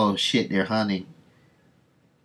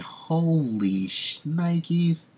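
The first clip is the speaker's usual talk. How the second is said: holy shnikes; 9 semitones higher, much slower than usual. Real, not acted. Gruesome trophy room reveal.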